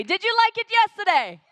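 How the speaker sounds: full shout to the crowd. Real, not acted. MC demanding a bigger cheer, shouting high. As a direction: really shout it to get the room going. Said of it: "You were screaming much better yesterday".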